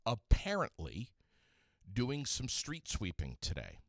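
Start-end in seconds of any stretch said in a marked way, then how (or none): 0.1-1.1 apparently punched louder with a rising tune; stress on apparently. Shown as a demonstration. Host to listeners, doubting the street sweeping, stressing apparently. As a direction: hit apparently hard and let it rise. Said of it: "I'm even gonna say this word".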